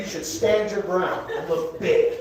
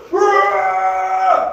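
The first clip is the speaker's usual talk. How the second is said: high, full-out Bigfoot-call roar; loud holler; 13 semitones higher, 6 dB louder than usual. Acted. Bigfoot hunters calling into the woods, high loud roar. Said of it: "what's hollering back at them".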